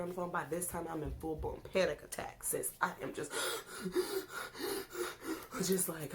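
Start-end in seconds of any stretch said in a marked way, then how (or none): 3.4-5.6 sharp high panicked gasp. Retold or imitated. Telling sis about the panic attack, a high panicked gasp.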